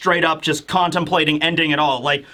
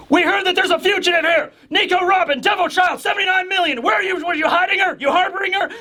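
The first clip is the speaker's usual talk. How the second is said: high, hammed-up yell of raiding officers; shouted interrogation; 12 semitones higher, faster than usual. Officer raiding a home for a fugitive, acted shouting. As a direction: bark accusing questions as if bursting in. Government men bursting in, hunting fugitive.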